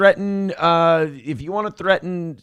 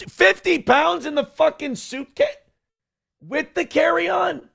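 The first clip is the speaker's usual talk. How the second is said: very high, drawn-out incredulous questions; mock disbelief; 11 semitones higher, slower than usual. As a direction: squeal it slowly in exaggerated disbelief. Acts out disbelief at luggage weight.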